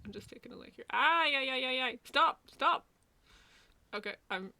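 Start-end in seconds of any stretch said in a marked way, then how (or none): 0.9-2.8 higher, louder playful stop; loud protest. Real, not acted. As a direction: cry out stop to the screen. Reacting to something on screen.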